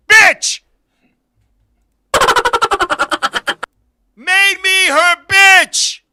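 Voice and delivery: loud, slow punchline repeat; hammed-up slow loud repeat; loud emphasis. Real, not acted. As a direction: drag out the punchline loudly. Loud retelling, slowed repeat.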